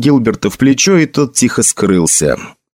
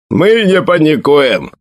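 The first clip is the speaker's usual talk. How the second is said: slow, higher mocking retort; 4 semitones higher, slower than usual. A narrator's reading. Soldier answers his commander's order, mockingly. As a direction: jeer back lightly from the ranks. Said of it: "сказал кто-то насмешливым голосом".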